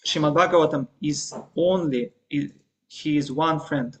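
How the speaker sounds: usual speaking voice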